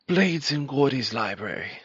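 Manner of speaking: hammed-up posh British host voice; playful fake British accent; mock British accent. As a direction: put on a jokey British accent to open the show. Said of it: "I'm doing a perfect British accent".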